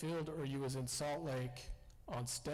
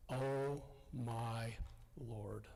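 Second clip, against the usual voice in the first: understated shocked murmur, imitating someone overheard; retold dismay; 4 semitones lower, much slower than usual. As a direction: retell a grave "oh my Lord", sinking at the end. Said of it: "it was like something was really wrong".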